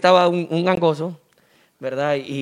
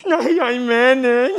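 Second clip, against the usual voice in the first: higher nasal-speech whine; nasal-speech guy; 5 semitones higher, much slower than usual. Acted. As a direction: whine it through the nose. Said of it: "le dice el gangoso". (gangoso quejumbroso, agudo)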